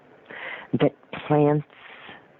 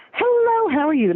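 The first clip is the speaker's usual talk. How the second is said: bright, much higher hello to a plant; cheery high greeting. Retold or imitated. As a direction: greet the plant like an old friend. Friend chatting up houseplants.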